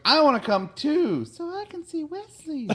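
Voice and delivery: put-on very high, childish begging voice; whiny little girl. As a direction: beg like a lovestruck little girl. Girl begs the governess.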